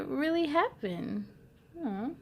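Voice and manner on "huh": ordinary delivery, nothing marked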